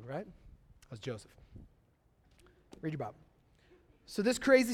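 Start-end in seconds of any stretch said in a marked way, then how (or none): 0.1-3.1 muttered aside. Real, not acted.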